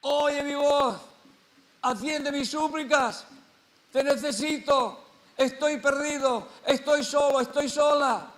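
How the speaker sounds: high, loud cry for help to God; drawn-out, pleading shouted prayer; desperate plea. Acted. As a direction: call out pleadingly at full voice. Acted desperate prayer crying out to God.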